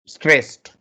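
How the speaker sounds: normal talking voice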